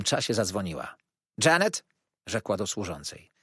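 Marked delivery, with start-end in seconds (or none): 1.4-1.8 calling out. A narrator's reading. Aunt calls the maid.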